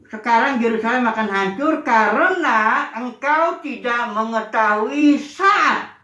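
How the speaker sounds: each word laid down slowly and deliberately; slow scripture reading. Acted. Preacher reading the verse to the congregation, slow and solemn. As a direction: read the verse out slowly, weighing it.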